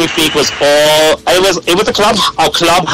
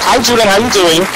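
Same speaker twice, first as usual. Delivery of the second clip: friendly greeting; 7 semitones higher than usual. Retold or imitated. Acted friendly greeting, unlike rivals' threats.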